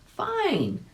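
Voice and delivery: high bright exclaim; monkey agreeing to the deal. A narrator's reading. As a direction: exclaim it happily. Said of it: "exclaimed the monkey".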